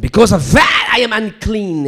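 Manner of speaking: loud burst then down; preaching cry. Acted. Preacher crying out the prophet's shame, loud.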